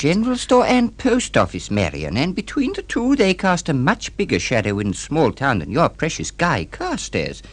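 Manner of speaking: ordinary talk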